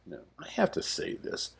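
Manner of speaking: a conspiratorial whispered interjection; whispered aside. Acted. Narrator leans in to the audience with a whispered aside. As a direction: slip in a quick whisper, as if confiding.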